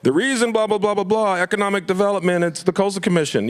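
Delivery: lightly sneering imitation of a politician; mocking politician. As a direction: parody the excuse-making electeds. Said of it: "electeds who go".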